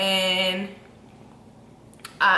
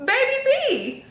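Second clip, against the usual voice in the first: very high, laughing reveal; mock astonished tech; slower than usual. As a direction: blurt it high through a laugh. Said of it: "She was like".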